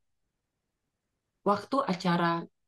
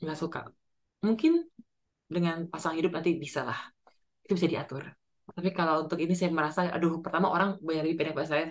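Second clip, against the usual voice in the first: ordinary delivery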